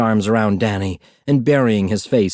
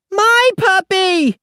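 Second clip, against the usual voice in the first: put-on high, slow drawn-out toddler howl; 3 dB louder, much slower than usual. A narrator's reading. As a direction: wail it long like a desperate child. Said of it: "Aki howled".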